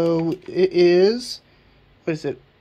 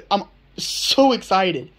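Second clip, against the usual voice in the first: ordinary talk, nothing marked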